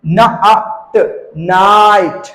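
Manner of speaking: न, आ, ट apart, then long नाइट; sounded out slowly. Shown as a demonstration. Teacher showing learners the आई sound, slowly blended. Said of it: "आई प्रोनाउंस करेंगे".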